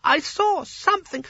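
very high, slow little voice; timid small wizard, insistent. Acted. Small wizard protests to Sconner, high and insistent. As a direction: protest in a high, thin-skinned squeak.

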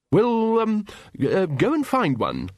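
high, swooping hesitation; flustered Ridcully. A narrator's reading. Wizard tells Vimes to find best man, flustered. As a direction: start high, then drop into the order.

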